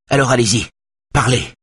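put-on nervous young man, voice drawn tight; tense. A narrator's reading. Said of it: "d'une voix tendue".

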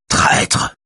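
rough, restrained roar. A narrator's reading. Raphaël accuses Axel of betrayal, roaring. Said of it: "rugit-il".

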